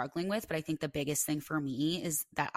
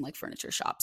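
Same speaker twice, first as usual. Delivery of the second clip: usual speaking voice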